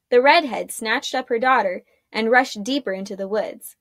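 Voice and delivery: plain reading voice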